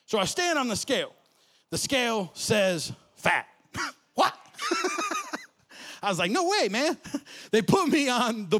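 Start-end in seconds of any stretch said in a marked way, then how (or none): 1.7-3.5 slow, deliberate punchline; slow deadpan. Real, not acted. Comic punchline slowed. 6.3-7.0 high indignant disbelief; disbelieving. Retold or imitated. Retold disbelief.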